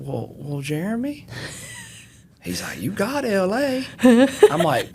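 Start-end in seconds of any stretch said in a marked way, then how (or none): 0.1-1.3 drawn-out Southern Mississippi drawl, pitched higher. Retold or imitated. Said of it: "he had that Mississippi drawl". 2.8-3.8 drawn-out Southern Mississippi drawl, pitched higher. Retold or imitated. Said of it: "he had that Mississippi drawl".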